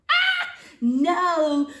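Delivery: high loud startled ah, then no; shocked protest; alarmed cry. Acted. Sophie panics at erasing choice.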